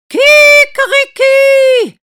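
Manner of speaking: very high, very loud slow crow; rooster crow. Acted. Rooster interrupts the song, crowing loud and high. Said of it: "pfeifen zusammen ein Kikeriki".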